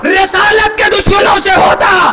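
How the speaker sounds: strained, shouted sermon climax; full-force preaching. Acted. Preacher argues before the slogan, full-force preaching. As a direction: build the argument toward a rallying cry.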